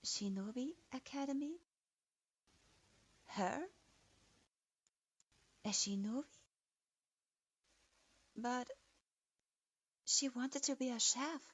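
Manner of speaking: a touch of stunned disbelief. A narrator's reading. Girl told she'll be a shinobi, mild disbelief. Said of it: "stared in disbelief".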